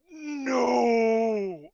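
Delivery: long, drawn-out loud "no"; yell. Acted. Character yells as he pulls out the bow, long loud yell. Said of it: "He yells".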